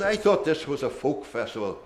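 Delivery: a wry Dundalk voice, mock-disgusted; Dundalk accent. Paddy Turrell mocking the mummers at the folk festival, Dundalk accent. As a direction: grumble the joke in a broad Dundalk accent. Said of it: "he says, in a Dundalk accent".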